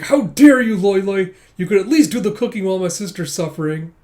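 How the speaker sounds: loud, high mock indignation; indignant scolding. Brother scolds husband as sister suffers, indignant outburst. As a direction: scold him with hammed-up outrage.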